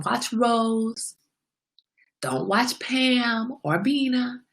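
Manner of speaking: slow, measured list of names; slow, deliberate. Acted. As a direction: spell each name out slowly as a warning.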